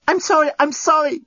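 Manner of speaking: high, frantic apology; yelled apology. A narrator's reading. Lucy to the wounded journalist, yelling sorry. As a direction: cry out in a hurry to apologise. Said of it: "yelled Lucy".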